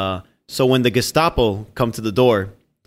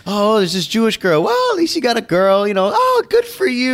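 mock giddy squeal; 7 semitones higher than usual. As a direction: coo it like a delighted buddy. Voicing giddy friend about girlfriend.

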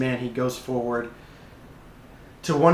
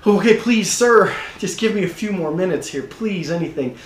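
high, urgent begging; acted desperate plea, starting loud; pleading; 6 semitones higher, 4 dB louder than usual. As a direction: plead with a creditor for a few minutes. Acted debtor begging for time.

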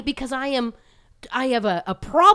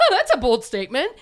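laughing it off through the words; nervous laugh to cover the awkwardness; said with an awkward laugh, louder; uncomfortable laugh; 8 semitones higher, 9 dB louder than usual. Retold or imitated. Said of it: "I laugh when I'm uncomfortable".

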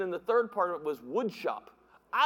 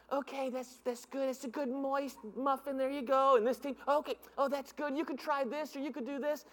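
quiet, higher teacher voice; soft-spoken teacher; 3 semitones higher, 6 dB quieter than usual. Retold or imitated. Teacher quietly praising another team's muffins. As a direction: hush it like a pleased teacher.

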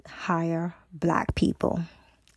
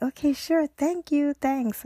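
eager thanks; 9 semitones higher than usual. Retold or imitated. Girls thank the manager.

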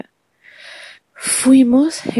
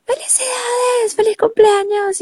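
high excited yell of happy birthday; shouted congratulations. Retold or imitated. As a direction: shout it, thrilled. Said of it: "le grité".

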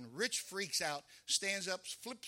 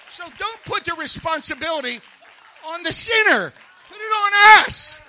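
loud, high, fervent preaching; a pastor shouting the point home to his church; full-force preaching; 4 semitones higher, 16 dB louder than usual. Real, not acted. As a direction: preach it out with rising fire.

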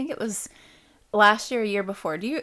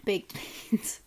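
whispering; 5 dB quieter than usual. Real, not acted. Whispered echo of the food list.

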